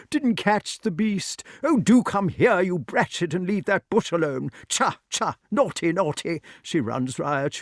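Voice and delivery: high voice scolding a dog in bursts; dotty knight losing control of his dog; flustered eccentric king. Acted. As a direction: fuss and call at the hound, high and exasperated. Pellinore scolds his unruly dog.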